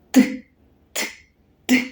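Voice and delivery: separate, voiceless "т" taps with pauses between; hammer tapping sound. Shown as a demonstration. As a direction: tap out "т" like a hammer. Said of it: "Як стукає молоток?". (стукіт молотка: коротке окреме «т»)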